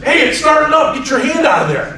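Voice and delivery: fake alarm. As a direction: shout a fake warning to scare him. Grandfather pranking, fake alarm.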